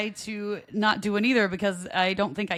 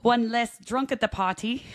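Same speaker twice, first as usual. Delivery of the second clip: a hammed-up, badly faked Irish brogue; a lightly put-on stage Irish voice; terrible Irish accent; 4 semitones higher than usual. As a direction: deliver the punchline in a bad mock Irish accent. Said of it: "That's a terrible accent".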